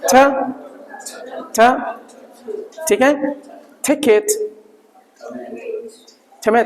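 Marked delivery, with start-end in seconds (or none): 0.0-1.6 T said with a puff of h, written छ; aspirated T sound. Shown as a demonstration. Teacher showing the sound of T, aspirated छ. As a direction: let some h into the T sound छ. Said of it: "थोड़ा एच लेके आना".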